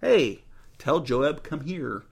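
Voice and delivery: ordinary delivery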